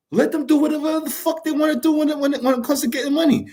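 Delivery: worked-up, high-pitched rush of stumbling words; passionate mumbling. Real, not acted. Man urging parents to let grown children go, passionate mumbling. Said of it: "I'm sorry, I'm mumbling".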